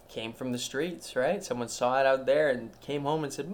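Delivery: ordinary talk, nothing marked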